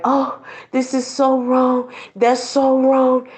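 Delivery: high, slow prissy mock outrage; mock pearl-clutching. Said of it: "some people act like".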